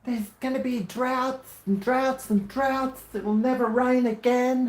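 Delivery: mock the climate worriers with a wailing prophecy; doom-laden greenie parody; mock doom-saying greenie. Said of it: "That'll throw the greenies off".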